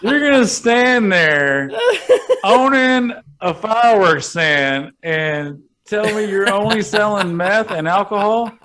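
can't believe what he's hearing; laughing disbelief. Real, not acted. Mocking his co-host's meth answer, laughing in disbelief.

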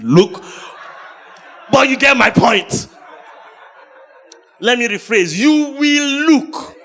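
loud, high, laughing preaching; a preacher laughing while he hollers; laughing shout. Real, not acted.

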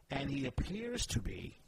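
"appears" lifted and leaned on; stress on appears. Shown as a demonstration. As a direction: put the weight on the word "appears". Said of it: "put the emphasis on the word appears".

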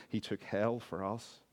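ordinary talk, nothing marked

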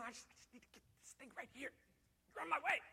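soft, fast rushed mutter; hurried shopper. Retold or imitated. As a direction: push past the buggy, fast and soft.

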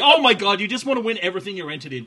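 teasing disbelief in a hammed-up voice; ribbing a champion who wants to win it all, in mock exasperation. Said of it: "And it's like".

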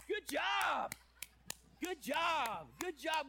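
high, loud hammed-up praise; mock cheering. Coworker cheering the boss out of the bathroom, loud mock praise. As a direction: cheer a grown coworker like a toddler.

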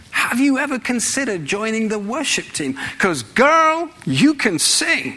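a cheerful American voice cheering on a singer; American accent. Pretend. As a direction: hype her up like an American friend. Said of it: "you don't have to say it in an American accent".

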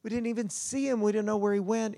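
high-pitched retold anguish over a missing child; mildly acted tearful cry, pitched well up; imitated crying. Parents crying to the fisherman over their lost son, imitated tearful voice. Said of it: "they're just crying".

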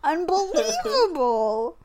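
high, laughing unbelievable; laughing disbelief. Real, not acted. Reacting to the movie's audacity, laughing. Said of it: "laughing in disbelief".

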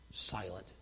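normal talking voice

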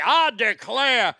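loud, high, slow mock-Southern cry; hammed-up Southern drawl; fake Southern accent. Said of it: "a character in a movie say".

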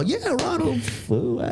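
high, drawn-out crowd cheer at modest volume; mock cheer. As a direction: imitate an audience cheering a stranger. Mock cheer of a baffled audience.

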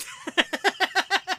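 high laugh. Real, not acted. Laughs at the sting.

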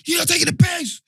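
retold confrontation with police, shouting high and angry. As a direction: yell it at the officers, furious. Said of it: "just went mad".

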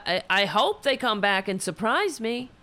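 usual speaking voice

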